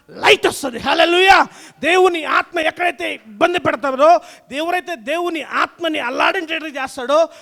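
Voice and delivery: loud shouted preaching climax; preaching at full force. Acted. Pastor shouting to the congregation at the sermon's peak. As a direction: drive it home, shouting praise.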